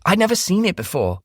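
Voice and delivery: desperate younger sister swearing innocence; Shelby's voice. A narrator's reading.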